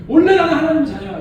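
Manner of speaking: loud, proud declaration; confident declaration. Shown as a demonstration. Preacher modelling a proud believer's declaration. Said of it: "이렇게 말하든지 차라리".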